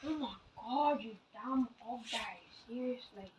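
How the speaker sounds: muttered insult. Acted. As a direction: grumble it under your breath.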